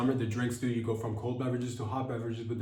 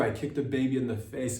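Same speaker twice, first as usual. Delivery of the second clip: ordinary talk, nothing marked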